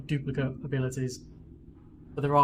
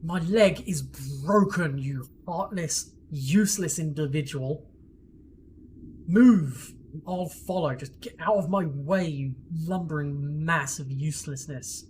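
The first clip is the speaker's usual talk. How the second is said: slow, loud, furious insults with a yelled move; injured Aniva snapping back at Horgus; angry retort; 4 dB louder, slower than usual. Acted. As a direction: snarl back with contempt. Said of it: "And she's like".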